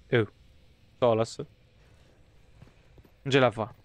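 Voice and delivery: normal talking voice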